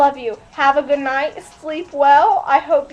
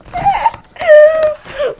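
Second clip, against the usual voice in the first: high, loud cackling laugh; real helpless laughter; loud shrieking laugh; 10 semitones higher, 8 dB louder than usual. Real, not acted. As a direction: burst out in a shrill laughing fit.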